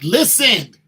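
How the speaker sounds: loud, high, slow "sign the prenup"; urgent demand. Retold or imitated. Groom to bride on the wedding day, loud and insistent. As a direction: lean in hard and insist.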